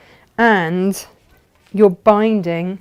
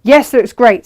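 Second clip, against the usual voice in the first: lightly voiced approving viewer, louder; reading a viewer's comment; 4 semitones higher, 11 dB louder than usual. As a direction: read it as a keen fan. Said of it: "says Patricia".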